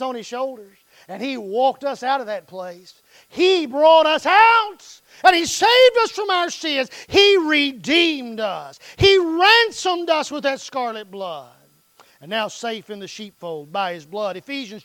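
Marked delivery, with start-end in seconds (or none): 3.3-11.5 loud, high preaching cry; preaching at full force. Acted. Preaching climax.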